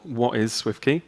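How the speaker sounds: usual speaking voice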